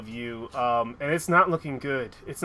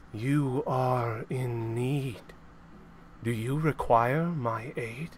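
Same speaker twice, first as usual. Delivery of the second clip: mysterious voice; 4 dB quieter, slower than usual. Acted. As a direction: draw it out like a spirit in the dark. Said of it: "You hear a voice, and it says".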